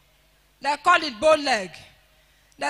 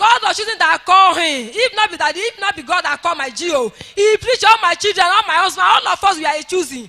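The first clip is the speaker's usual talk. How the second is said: loud, forceful church prayer; fervent prayer; 3 semitones higher, 6 dB louder than usual. Real, not acted. Testifier blessing the daddy G O before church, fervently. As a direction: pray it out with full force. Prays aloud for the G O.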